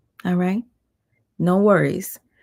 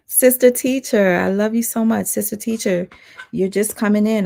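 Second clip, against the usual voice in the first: higher, louder happy shout-out; excited greeting; 5 semitones higher, 5 dB louder than usual. Real, not acted. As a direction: call out to a friend arriving. Greeting a viewer joining the live.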